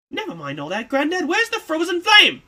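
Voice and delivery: high, loud demanding push; pry the answer out of him, fast; annoyed and desperate. Acted. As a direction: Kid pressing Granddad for the Frozen Flame, high and loud. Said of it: "annoyed or desperate".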